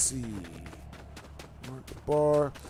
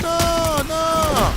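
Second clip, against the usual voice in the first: high, loud, drawn-out mock refusal; imitated refusal; 11 semitones higher, 5 dB louder than usual. Telling the begging girl he won't touch her, mock refusal. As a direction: hold each word long and high.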